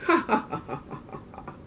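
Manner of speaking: villainous cackling laugh, fading to breath; maniacal laugh. A narrator's reading. Uncle and principal laughing maniacally over their scheme. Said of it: "They both laughed maniacally".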